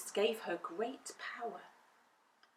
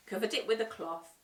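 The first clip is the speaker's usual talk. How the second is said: ordinary delivery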